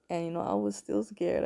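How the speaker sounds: usual speaking voice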